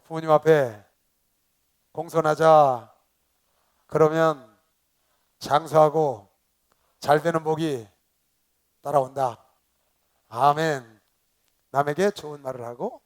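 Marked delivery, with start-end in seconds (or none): 0.0-11.0 loud, measured congregational prompting; lead for repetition. Shown as a demonstration. Pastor has congregation repeat after him, loud call-out. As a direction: call out each phrase loudly to be echoed. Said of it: "따라하세요".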